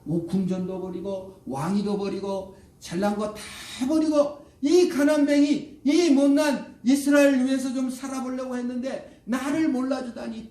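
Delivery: high, loud aggrieved outburst; acted feeling of betrayal. Moses feels betrayed by ungrateful Israelites, aggrieved outburst. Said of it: "배반감을 느끼죠".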